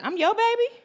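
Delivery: high, delighted mock surprise; playful surprise. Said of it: "I said".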